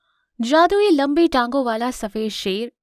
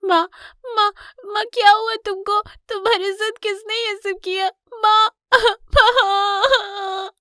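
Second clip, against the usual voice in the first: put-on very high, loud child voice, breaking into a wail; frightened cub; 11 semitones higher, 4 dB louder than usual. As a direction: panic over your hurt mother, then wail. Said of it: "कियोन बहुत डर जाता है". (डरे हुए बच्चे की ऊँची, रोती पुकार)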